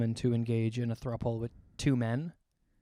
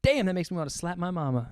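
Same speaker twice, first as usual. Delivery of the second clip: put-on cowboy with a Southern accent, pitched up; higher-pitched Southern exclamation; 4 semitones higher, 3 dB quieter than usual. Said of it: "No, that's, I'm doing Southern".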